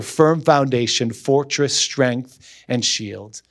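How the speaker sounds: usual speaking voice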